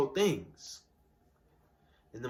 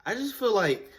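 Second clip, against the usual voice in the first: ordinary delivery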